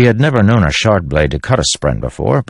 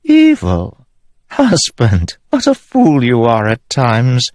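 very high, contemptuous sneer; queen mocking her husband as a fool; scornful queen; 7 semitones higher, slower than usual. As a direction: belittle him slowly.

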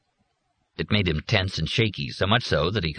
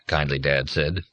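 ordinary delivery, nothing marked

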